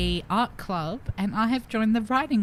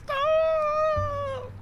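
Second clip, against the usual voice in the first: squealed stop. Real, not acted. Laughing protest.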